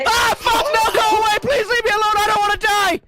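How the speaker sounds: very high, loud panicked scream; terrified screaming. Real, not acted. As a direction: scream for your life at a monster. Chased by mimic monster.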